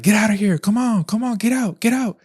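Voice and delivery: high, urgent yelling to chase it off; trying to sound big and tough, shouting it away; retold scream. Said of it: "I'm just screaming at her".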